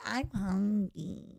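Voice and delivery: put-on slow, whiny baby voice begging for food. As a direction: play the needy baby of the house. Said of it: "I get to be like".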